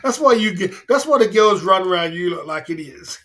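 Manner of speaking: loud laughing telling-off; loud mocking laugh at the viewers; laughing rant. Real, not acted.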